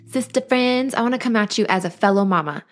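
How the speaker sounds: usual speaking voice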